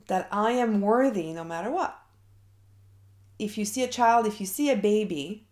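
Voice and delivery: ordinary talk, nothing marked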